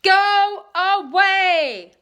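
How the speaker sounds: loud, high, very slow shout. Acted. The boy shouts at the tornado, loud and slow. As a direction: drag it out at full volume. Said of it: "Holler shouted at the tornado".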